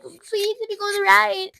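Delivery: imitating a friend's whiny begging; mock whiny begging. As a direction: beg like a whiny kid.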